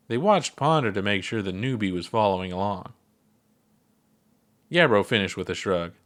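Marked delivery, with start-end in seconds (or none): none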